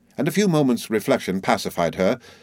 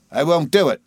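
high, loud-edged defiance; raised, indignant outburst; defiant refusal; 7 semitones higher, 4 dB louder than usual. A narrator's reading. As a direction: refuse angrily as if swearing. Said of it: "with an oath".

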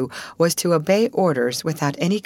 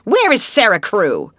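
louder, fierce, clipped demand; 5 semitones higher, 7 dB louder than usual. A narrator's reading. Miss Minchin demands of her sister, fierce. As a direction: demand it like a threat. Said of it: "voice was almost fierce".

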